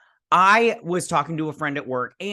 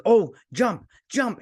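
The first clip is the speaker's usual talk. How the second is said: excited; 10 semitones higher, 5 dB louder, slower than usual. Retold or imitated. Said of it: "I was like".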